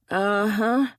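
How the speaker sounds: dubious, drawn-out uh-huh; a knowing, unconvinced uh-huh; skeptical. Acted. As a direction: let the doubt show in the grunt. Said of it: "Barney's tone was skeptical".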